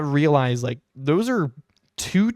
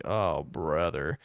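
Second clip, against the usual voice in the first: low, slow, weary oh brother; a deflated sigh of a phrase; exasperated groan; 9 semitones lower, 6 dB quieter, much slower than usual. Retold or imitated. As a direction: sag under the news of the wait. Hears the long wait time.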